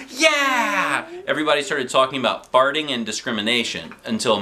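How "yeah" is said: cheer. A narrator's reading. Kids cheer.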